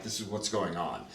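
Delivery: ordinary talk, nothing marked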